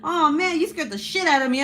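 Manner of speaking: loud and high, laughing through the words; laughing shout. Real, not acted. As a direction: shout it out while cracking up, real relief. Laughing off the scare.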